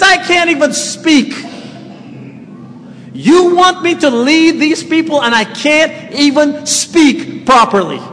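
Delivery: high, protesting complaint; whining Moses. Acted. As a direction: complain you are not up to it. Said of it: "He says".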